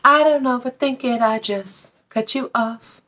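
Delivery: put-on light breathy-free high girlish quote, slowing at the end. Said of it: "Like [name] was saying".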